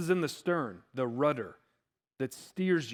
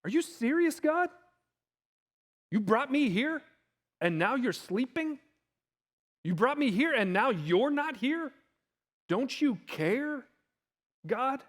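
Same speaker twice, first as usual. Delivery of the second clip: high, aggrieved questioning; incredulous complaint to God; 6 semitones higher than usual. Acted. Believer in hardship questioning God, aggrieved and incredulous. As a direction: challenge God, pitched high, question after question. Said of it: "have said something like that".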